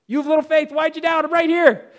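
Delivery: high, insistent mock disbelief; exasperated Jesus. Jesus to doubting Peter right beside him, exasperated insistence. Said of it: "And Jesus was telling him".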